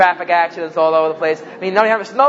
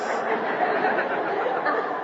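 laughing; 8 dB quieter than usual. Real, not acted. Laughing at own joke.

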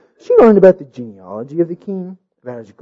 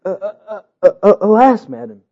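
timid stammer; 4 semitones higher than usual. A narrator's reading. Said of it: "he replied timidly".

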